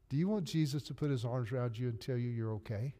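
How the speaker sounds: ordinary delivery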